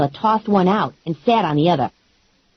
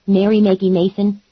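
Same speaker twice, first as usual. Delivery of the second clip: usual speaking voice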